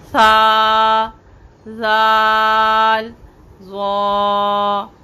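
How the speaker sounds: सा, जाल, ज़ा held long and slow; drawn-out letter names. Shown as a demonstration. Teacher reciting tongue-tip letters, drawn out. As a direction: stretch each letter. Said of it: "सा को बग़ैर सिटी के नरमी से".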